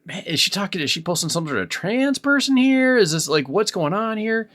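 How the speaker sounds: high-pitched, puzzled inner monologue; baffled questioning. Retold or imitated. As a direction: voice your bewildered first reaction, pitch climbing. Acting out his baffled first reaction.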